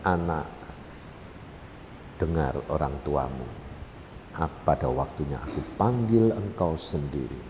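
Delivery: low, soft voice of God; gentle, loving. Retold or imitated. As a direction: repeat it tenderly and quietly. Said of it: "Dua kali diulang".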